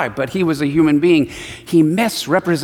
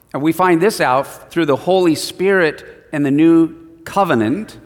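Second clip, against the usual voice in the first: ordinary talk, nothing marked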